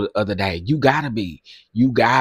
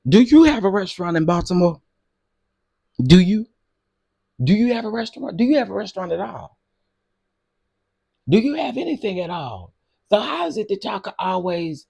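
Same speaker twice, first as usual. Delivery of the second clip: indignant challenge; 8 semitones higher than usual. Real, not acted. Challenging the critics.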